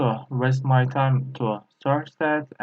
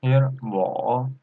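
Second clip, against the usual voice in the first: ordinary delivery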